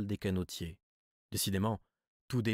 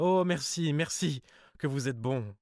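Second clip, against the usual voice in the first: high, louder burst of thanks; relieved cry; 6 semitones higher, 4 dB louder than usual. Acted. Cousin thanks the narrator for the money, crying out in relief. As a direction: exclaim your gratitude, overjoyed. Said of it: "Elle s'écria".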